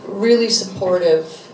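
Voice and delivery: ordinary delivery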